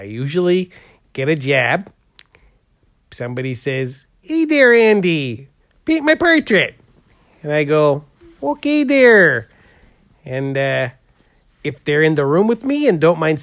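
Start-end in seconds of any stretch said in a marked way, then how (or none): 4.2-6.8 higher-pitched quoted customer voice; imitated client. Painter acts out a client asking for a portrait, high imitated voice. 8.4-9.5 high, drawn-out mock agreement; acted-out reply. Painter quotes himself accepting a job, high drawn-out reply. Acts out own reply to client.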